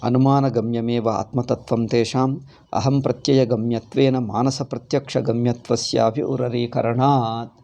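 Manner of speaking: normal talking voice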